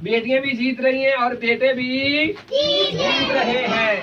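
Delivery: slow, drawn-out calls to the crowd; slogan calling. Acted. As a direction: lead the crowd in call and response. Leads slogans for a children's group. (बच्चों से नारे लगवाते हुए पुकार)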